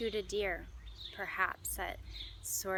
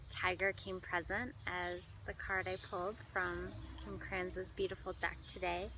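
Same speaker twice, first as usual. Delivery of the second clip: ordinary talk, nothing marked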